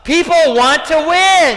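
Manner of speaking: high, loud insistence; full-voiced, exasperated declaration; emphatic shout. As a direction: shout it as an indignant rebuttal.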